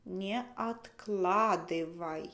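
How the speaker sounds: usual speaking voice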